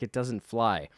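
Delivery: ordinary talk, nothing marked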